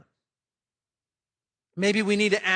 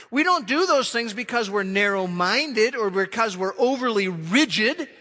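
loud, driving sermon delivery; forceful preaching; 4 semitones higher, 6 dB louder than usual. Acted. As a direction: preach it hard to the congregation.

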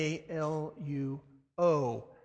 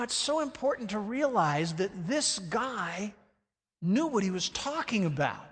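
ordinary delivery

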